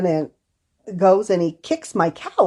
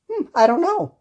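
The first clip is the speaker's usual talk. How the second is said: puzzled musing; 9 semitones higher than usual. Retold or imitated. Therapist imitating herself when asked, high puzzled hum. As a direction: sound honestly stumped. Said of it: "I'll be there like".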